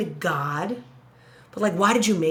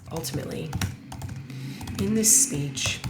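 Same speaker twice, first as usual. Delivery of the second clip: "ultimately" muttered much softer and lower, testing it; muttered to herself; 5 semitones lower, 6 dB quieter than usual. Shown as a demonstration. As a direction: mumble "ultimately" under your breath, weighing it. Trying out transition words.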